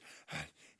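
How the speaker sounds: winded exhale after running; breathless gasp. Retold or imitated. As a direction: gasp for air. Messenger out of breath.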